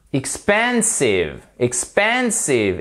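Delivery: "expensive" slowly, middle syllable PEN stretched and stressed; correct English stress. Shown as a demonstration. Teacher modelling "expensive" for learners, slow with stressed PEN. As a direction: lean long on PEN in "expensive". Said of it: "et pas expensive".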